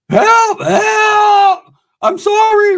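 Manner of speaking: high, loud hammed-up yelp for help; mock cries for help. Inmate beaten by cops, retold.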